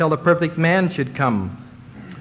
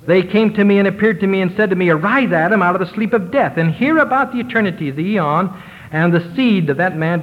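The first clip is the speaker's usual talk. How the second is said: raised, proclaiming scripture voice; elevated reading; 5 semitones higher than usual. Acted. Preacher reads the angels' call to Adam, raised and proclaiming.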